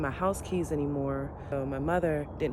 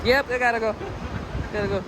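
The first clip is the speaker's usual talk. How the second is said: scared; 8 semitones higher, 9 dB louder, faster than usual. Real, not acted. Scared on the walkway.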